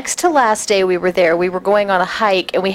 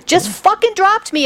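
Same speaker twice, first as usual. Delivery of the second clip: ordinary talk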